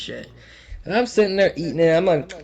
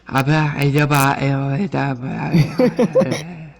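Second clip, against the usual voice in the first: droning gibberish babble; mock lecture in nonsense syllables; unintelligible teacher. Teacher teaching away to a dazed student, garbled babble. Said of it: "all I can understand is".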